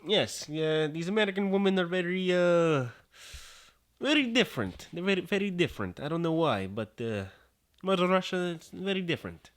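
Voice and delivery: high-pitched mock Russian accent. Guest explaining American women to host, in a playful Russian accent. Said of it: "My Russian accent".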